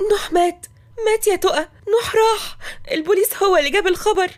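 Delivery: very high, crying voice; tearful shock. Acted. Sister telling the bride her groom died, tearful. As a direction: wail the terrible news. Sister breaking news of death.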